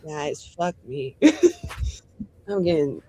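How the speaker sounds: normal talking voice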